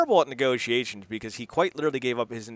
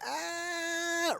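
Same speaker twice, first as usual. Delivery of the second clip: high pained cry; 9 dB quieter than usual. Retold or imitated.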